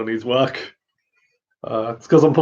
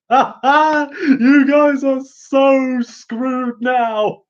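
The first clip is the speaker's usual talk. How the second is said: much higher and louder gloating laugh, stretched very slowly; triumphant shout; 10 semitones higher, 3 dB louder, much slower than usual. Retold or imitated. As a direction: crow over the other heroes like a victorious Thor. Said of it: "they were like".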